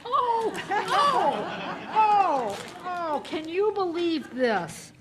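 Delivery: high, thrilled exclamations; delighted. Real, not acted. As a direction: gasp with real delight. Gift unwrapped, delighted.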